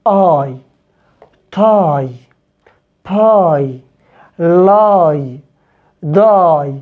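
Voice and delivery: آي said as a long English "eye"; English ie sounds. Shown as a demonstration. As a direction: read the words تاي، باي، لاي، داي with a clear "ai" glide at the end. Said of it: "بنطقها". (نطق إنجليزي واضح لصوت آي)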